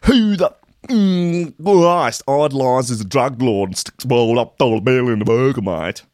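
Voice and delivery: outraged Aussie punter, hammed-up and loud; mock outraged Aussie rant. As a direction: rant it like an offended bloke down the pub. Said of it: "wrote another".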